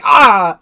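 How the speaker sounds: loud high startled yelp; mock yelp. Enemy punched in the face, loud mock yelp. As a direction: cry out like you've been punched. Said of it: "and they're like".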